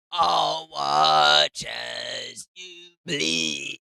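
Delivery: loud, slow villain threat; dramatic shout. Acted. Dramatic line mid-game.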